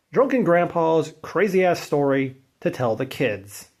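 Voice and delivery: ordinary delivery, nothing marked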